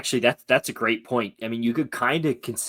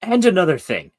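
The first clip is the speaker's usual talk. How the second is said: mock rant opener; 9 semitones higher, 8 dB louder than usual.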